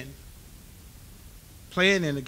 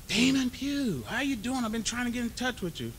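much higher, chipper official voice; imitating the caller; 10 semitones higher than usual. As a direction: play the cheerful stranger on the line. Hall of Fame caller voiced.

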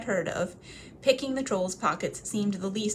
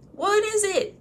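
curious trolls; 13 semitones higher, 6 dB louder than usual. Acted. Trolls asking.

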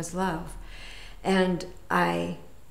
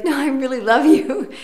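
higher and louder eager insistence; insistent and excited; 8 semitones higher, 9 dB louder than usual. Retold or imitated. Insisting to the garage guy she really loves him, overjoyed. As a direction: gush it, you truly mean it.

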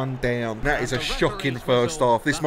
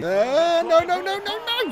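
high, rising string of no's; alarmed gamer wail; panicked protest; slower than usual. Real, not acted. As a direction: panic as the goal comes under threat. Danger at his goal.

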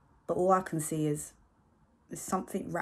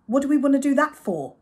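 much louder and higher, exasperated; exasperated repeat; 9 semitones higher, 11 dB louder than usual. Acted. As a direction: repeat it, losing patience. Mum repeating, more exasperated.

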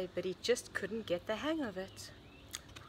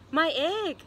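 loud, higher-pitched proud announcement; 6 semitones higher, 8 dB louder than usual. A narrator's reading. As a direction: announce it with pride, voice lifted. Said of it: "said Pig proudly".

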